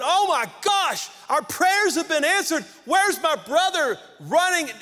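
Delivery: high, excited hypothetical cry of joy; joyful overjoyed brother. Pretend. Preacher voicing an imagined happy brother, excited. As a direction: gush with pretend delight as the glad brother. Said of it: "Instead of the older brother going".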